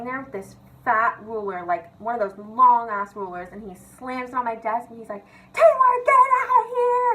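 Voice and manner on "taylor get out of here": high, loud mock teacher yell; full-voiced, high-pitched retold shout; imitated angry shout. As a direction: act out a teacher's furious outburst. Retelling teacher's angry outburst.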